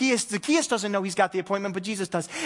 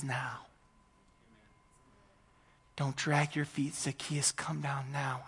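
gentle call; 6 semitones lower, 9 dB quieter than usual. Pretend. God gently calling Zacchaeus.

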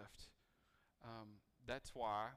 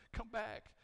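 high, loud cry of come back; desperate high call after Jesus; pleading call; 12 semitones higher, 8 dB louder than usual. Retold or imitated. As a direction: call out after someone leaving. Disciples calling after ascending Jesus.

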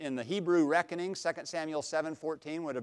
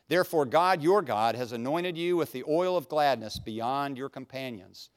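scripture reading; 3 semitones higher, 4 dB louder than usual. Acted. Reads scripture aloud.

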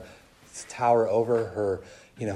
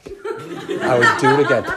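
laughing defiance; 7 dB louder than usual. Retold or imitated. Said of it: "I'm like".

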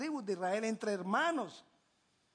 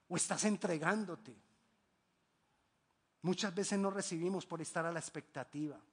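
normal talking voice

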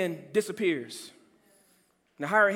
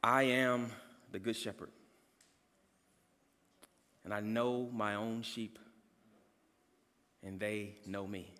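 soft scripture reading; 10 semitones lower, 10 dB quieter than usual. Real, not acted. Pastor reads Jesus' words to the congregation, hushed and reverent. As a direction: read the verse hushed and reverent.

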